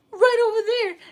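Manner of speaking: very high, loud reply; mock urgent shout. Viewers directing the streamer to the spot, urgently. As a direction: call it out in hammed-up urgency.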